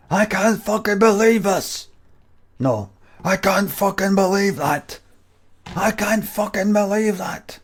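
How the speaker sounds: a hint of an outraged fan at the match; lightly done impression of a stunned terrace fan; imitating the Ayr fan. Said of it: "the guy at the Air in fucking".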